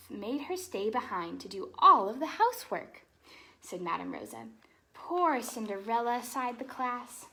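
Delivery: lively storybook read-aloud; animated picture-book narration; reading to children. Acted. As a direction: read it like bedtime story time for kids.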